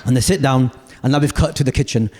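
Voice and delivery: ordinary talk, nothing marked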